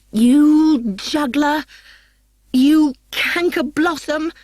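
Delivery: high, loud, slow screaming insults; enraged shout of acted fury; furious shout. A narrator's reading. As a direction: hurl each insult at her rival. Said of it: "shouted Hermia".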